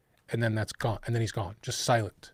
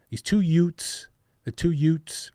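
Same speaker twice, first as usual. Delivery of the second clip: My Cousin Vinny quote; 5 semitones higher, 7 dB louder, slower than usual. Retold or imitated. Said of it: "Remember that?".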